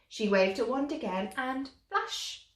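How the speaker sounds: plain reading voice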